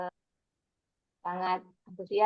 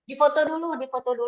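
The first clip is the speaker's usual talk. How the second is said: calling out; 5 semitones higher, 8 dB louder than usual. Real, not acted. Calling out for photo.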